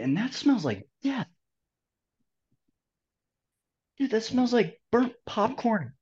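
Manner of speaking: high, strained voice of real disgust; reacting to a foul stink; smelling something awful. Real, not acted. Dog just farted nearby.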